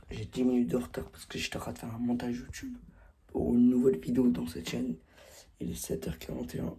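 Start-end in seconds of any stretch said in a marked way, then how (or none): 5.6-6.8 whispering. Real, not acted. Early morning hushed voice.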